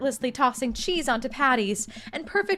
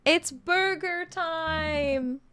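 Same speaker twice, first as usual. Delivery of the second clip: excited announcement; 6 semitones higher, much slower than usual. Acted. Dad announces burger time.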